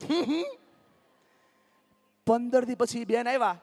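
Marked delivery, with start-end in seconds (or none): 0.1-0.4 mock artificial grin hum; forced fake smile hum. Shown as a demonstration. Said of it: "કરો તો બેન". (કૃત્રિમ સ્મિતનો હમ)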